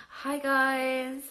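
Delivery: posh voice. Retold or imitated. Old song intros, viewer greeted in a posh voice. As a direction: put on a plummy upper-class greeting. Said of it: "really posh voice".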